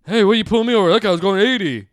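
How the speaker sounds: high, loud indignant complaint; acted-out outraged driver; protesting to cop. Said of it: "if you tell the cop".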